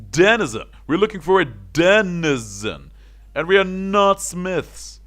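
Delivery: high, loud and slow; exasperated yell. Acted. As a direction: spell it out to the old man, furious. Guard losing patience with old man.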